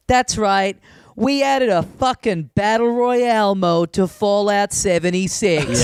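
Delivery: slow, swaggering parody of a game reveal; mock stage announcer. Presenter returning to stage after the trailer, mock hype. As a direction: imitate a presenter milking the big announcement. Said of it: "the guy goes".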